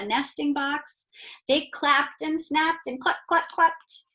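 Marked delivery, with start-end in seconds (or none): 1.5-4.0 higher, playful hen sounds; lively clucking. A narrator's reading. Chickens clapping and clucking.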